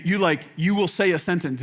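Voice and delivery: ordinary talk, nothing marked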